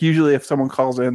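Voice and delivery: normal talking voice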